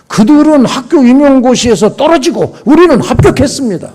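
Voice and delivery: high, loud, emphatic protest; acted indignant assertion. Teachers to pastor defending their ability, acted indignant boast.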